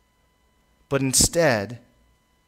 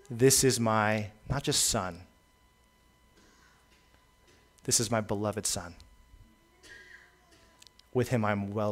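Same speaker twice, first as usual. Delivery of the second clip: tender voice from heaven; 4 semitones lower, 8 dB quieter than usual. Retold or imitated. Preacher voices the Father blessing his beloved Son: soft, tender pride.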